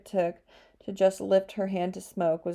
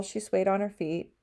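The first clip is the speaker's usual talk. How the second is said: usual speaking voice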